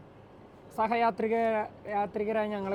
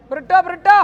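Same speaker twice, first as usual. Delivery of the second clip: loud call across a distance; 8 semitones higher, 14 dB louder than usual. Real, not acted. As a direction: shout the name to get attention. Calling friends outside.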